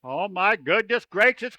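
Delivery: ordinary delivery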